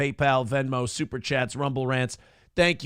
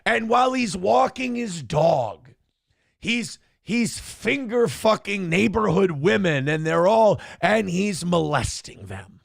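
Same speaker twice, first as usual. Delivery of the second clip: imitating PPP's frantic rant; 4 semitones higher, slower than usual. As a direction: spin a dog walk into a crime saga. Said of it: "and P P P would go".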